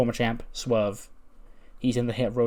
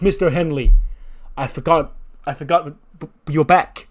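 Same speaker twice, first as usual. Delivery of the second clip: loud, higher, stammering astonishment; flustered surprise; 5 semitones higher, 9 dB louder than usual. Acted. Teacher astonished the bar owner is back, flustered surprise. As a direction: stumble over the words in surprise.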